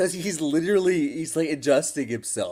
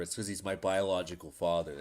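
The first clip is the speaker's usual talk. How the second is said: usual speaking voice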